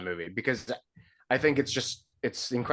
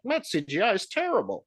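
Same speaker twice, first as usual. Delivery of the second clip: mock complaint; 9 semitones higher than usual. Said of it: "I wasn't like".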